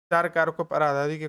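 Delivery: usual speaking voice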